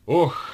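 sudden loud, high "oh"; loud groan. A narrator's reading. Captain spotting Vovka with the bottle, loud groan. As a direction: let out a loud "oh" of dismay.

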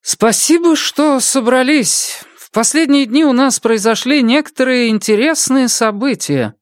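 high, measured public address; dignified chairwoman. Acted. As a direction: speak to the crowd with calm authority.

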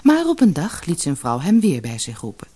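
ordinary delivery

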